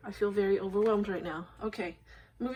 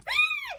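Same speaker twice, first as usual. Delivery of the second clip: high squealing burst of laughter; real delighted shriek of laughter; 5 dB louder than usual. Real, not acted. Reveal of last card.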